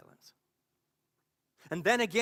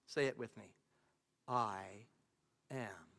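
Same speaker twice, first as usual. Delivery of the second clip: low, measured, with long pauses; calm divine voice; 5 semitones lower, 4 dB quieter than usual. Acted. God answering a desperate questioner, calm and low. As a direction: answer calmly, sinking softer at the end.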